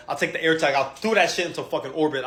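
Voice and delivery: ordinary talk, nothing marked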